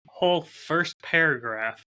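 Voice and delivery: ordinary talk, nothing marked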